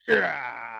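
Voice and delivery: lightly voiced wrestler grunt; a hint of a menacing wrestler; Undertaker growl. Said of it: "he does the Undertaker".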